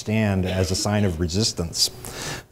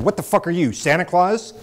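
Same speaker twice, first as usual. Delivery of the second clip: high, loud challenge from a gunnery sergeant; angry sergeant; 9 semitones higher, 3 dB louder than usual. Retold or imitated. Gunnery sergeant confronts the chained new prisoner, angry shout. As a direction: bellow it at a clueless newcomer. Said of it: "the angry man".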